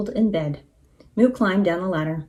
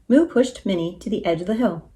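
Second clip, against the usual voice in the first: ordinary delivery, nothing marked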